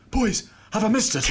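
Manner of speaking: high, loud retold exclamation; breathless-style mock alarm. Late teammate bursting in on the boys, mock panicked cry. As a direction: blurt it out high and loud, hammed up.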